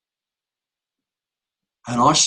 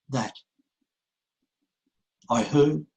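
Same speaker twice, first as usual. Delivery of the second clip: ordinary delivery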